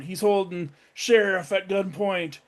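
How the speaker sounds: ordinary talk, nothing marked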